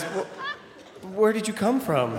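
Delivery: high, stammered question; mock baffled dad. Dad asks where the stranger came from, high and baffled. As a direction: stumble into the question amazed. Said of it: "and he says".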